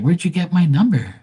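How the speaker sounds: high, startled question; be caught off guard; startled girl. Retold or imitated. Imitated girl surprised on call.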